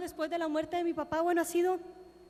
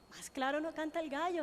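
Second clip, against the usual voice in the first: ordinary talk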